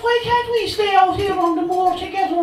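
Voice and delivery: Cathy, high and loud in bad Cork brogue; dodgy Cork accent. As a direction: gush at him in a hammed Cork lilt. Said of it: "delivered in a dodgy Cork accent".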